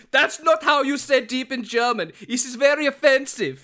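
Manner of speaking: loud, high, hammed-up German accent; mock German accent. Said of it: "That was a spot-on German accent".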